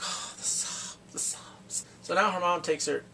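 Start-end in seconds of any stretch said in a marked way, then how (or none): 0.0-1.8 soft, voiceless whisper; hushed whisper. Real, not acted. Viewer reacting to the crying reunion, hushed whisper. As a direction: whisper it, overcome by the scene.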